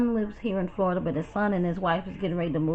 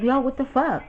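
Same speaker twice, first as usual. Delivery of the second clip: mild retold yell, raised in pitch; retold scream; 6 semitones higher, 4 dB louder than usual. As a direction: re-enact screaming at him, without a full shout. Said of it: "I'm screaming on you".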